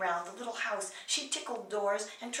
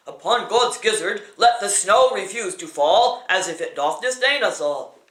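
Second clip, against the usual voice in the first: booming, much louder incantation in a changed voice; wizard's spell; 13 dB louder than usual. Acted. Wizard casting a spell against the blizzard, booming incantation. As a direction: declaim the spell at full voice like a sorcerer. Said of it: "and tried this spell".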